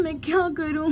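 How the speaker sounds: tearful wife, cow gone missing; acted crying. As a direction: act out a wife near tears over the lost cow. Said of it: "मुझे तो रोना आ रहा है".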